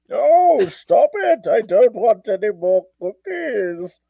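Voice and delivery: hammed-up masked villain protest; slow down into a theatrical refusal; Bane. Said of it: "do Bane doing the Cookie Monster".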